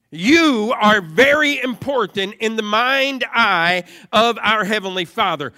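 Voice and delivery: loud, forceful preaching; emphatic preaching. Real, not acted. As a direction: press it home to the congregation.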